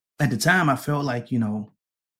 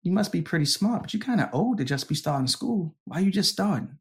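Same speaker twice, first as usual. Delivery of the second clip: questioning woman; 6 semitones higher than usual. Retold or imitated. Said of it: "the woman was like".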